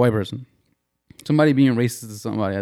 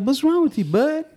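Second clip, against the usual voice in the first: squeaky, pitched way up, teasing; high-pitched mock concern. Mocking a friend's fake concern, high squeaky voice. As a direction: ask it in a silly high voice. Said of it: "He does the high pitch".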